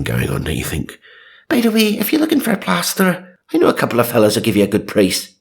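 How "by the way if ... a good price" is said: put-on high, botched Irish priest accent; attempted Irish accent. Reader mocks her own bad Irish accent for the priest. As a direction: fumble a squeaky Irish accent, fast at the end. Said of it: "I really can't do that accent".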